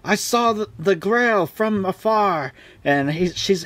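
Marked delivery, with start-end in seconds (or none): 0.0-2.5 high, slow mock-reverent knight voice; hammed-up knight. Galahad telling the women of the Grail, hammed up high and slow. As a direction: gasp it out like a starstruck knight, pitched high. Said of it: "He's like".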